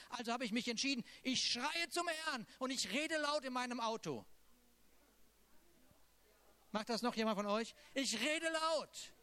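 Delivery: high, ringing preacher's voice, pressing each point; fervent preaching. Acted. Preacher urging the congregation to cry out, fervent. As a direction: lift it up and drive it home to the room. Preaches about crying to the Lord.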